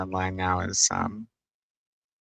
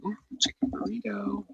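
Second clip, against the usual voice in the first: whispered delight; 12 semitones higher than usual. Real, not acted. Excited about lunch.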